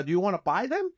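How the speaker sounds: ordinary talk, nothing marked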